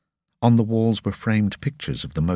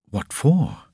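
startled question; 8 semitones higher than usual. Acted. Boy questions officers.

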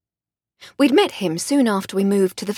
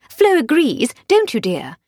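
higher, refined English lady; posh lady voice; 5 semitones higher, 4 dB louder than usual. Acted. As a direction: play the genteel lady of the house. Voiced Lady Hardcastle dialogue.

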